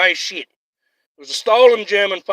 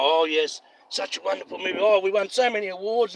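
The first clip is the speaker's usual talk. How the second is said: mock smug filmmaker; 7 dB quieter than usual. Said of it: "made a big giant".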